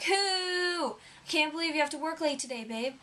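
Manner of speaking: high whiny "Who?", then a fast complaint; mock-outraged whine. To her husband working late, high mock-outraged whine. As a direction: whine it up high like a let-down wife. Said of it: "Or it'll be like".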